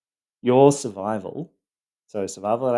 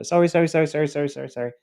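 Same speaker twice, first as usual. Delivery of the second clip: high, rapid-fire mock apologising; retold frantic fawning, starting loud and tailing off; fawning apology; 6 semitones higher, 4 dB louder, much faster than usual. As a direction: rattle off sorries to appease someone. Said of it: "I would constantly fawn, go".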